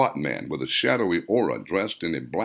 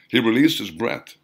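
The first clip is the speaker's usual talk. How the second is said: ordinary delivery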